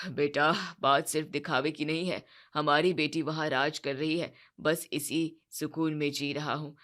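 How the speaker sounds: father explaining to his son why he hides the truth; resigned father. Acted.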